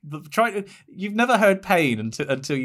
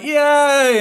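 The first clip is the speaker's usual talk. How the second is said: high, loud cheer; excited cheer; 11 semitones higher, 6 dB louder than usual. Retold or imitated. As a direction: cheer with joy. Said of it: "We're like".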